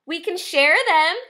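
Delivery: high-pitched friendly ladybug; sweet storybook peacemaker; friendly suggestion. A narrator's reading. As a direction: offer it kindly in a high little voice. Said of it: "suggested the friendly ladybug".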